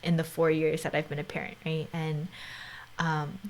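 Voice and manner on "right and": usual speaking voice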